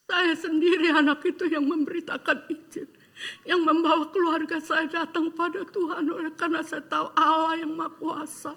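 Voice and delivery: real laughter and tears breaking into the testimony; overcome with joy. Real, not acted. To the congregation, recalling bringing his family to God, tearful and laughing.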